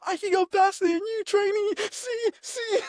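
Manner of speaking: high taunting sergeant voice; imitating a boastful sergeant; mock drill sergeant. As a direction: show off to the recruit like a sergeant. Sergeant showing off grabbing food.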